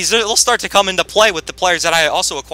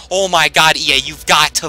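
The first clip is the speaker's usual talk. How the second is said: normal talking voice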